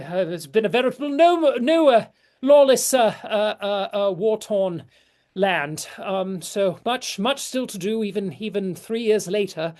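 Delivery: high, loud, effusive hostess welcoming guests; flustered lady of the house greeting a lord; gushing noble hostess. Acted.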